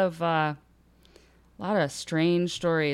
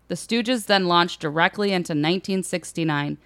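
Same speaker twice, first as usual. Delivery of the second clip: normal talking voice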